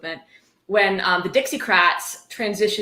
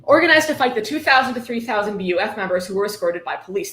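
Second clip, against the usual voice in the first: usual speaking voice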